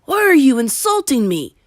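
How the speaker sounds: loud, rising, hurt indignation; affronted protest. Acted. Bruno protests to the boy, loud and affronted. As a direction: demand an answer, insulted.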